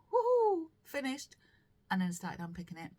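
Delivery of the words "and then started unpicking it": ordinary delivery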